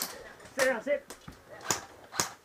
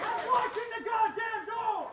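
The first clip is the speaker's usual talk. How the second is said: annoyed shout; 10 semitones higher than usual. Real, not acted. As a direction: snap back in the heat of the fight. Snapping back during firefight.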